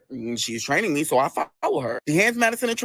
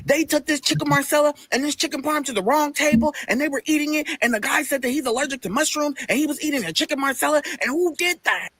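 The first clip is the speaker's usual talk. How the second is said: higher-pitched complaint, run on without a break; imitated upset coworker; 7 semitones higher than usual. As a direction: report a mistake in mock outrage. Man complains about wrong table.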